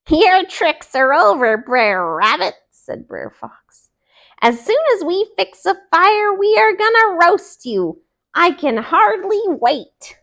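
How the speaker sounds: high, loud gleeful fox; gloating fox. Acted. Fox gloats to the trapped rabbit, high gleeful.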